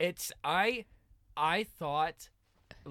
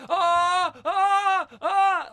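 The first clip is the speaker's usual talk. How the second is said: loud, high mock yells in bursts; repeated imitated screams; Brandon; 15 dB louder, much slower than usual. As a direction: imitate a man screaming, yell after yell. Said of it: "I also hear Brandon going".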